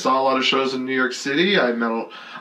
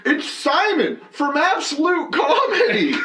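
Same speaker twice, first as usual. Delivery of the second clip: high, drawn-out mock announcement; slow, high heckler impression with a laugh; imitated heckler; slower than usual. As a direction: proudly call it out to the stage, hammed up. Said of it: "he's like".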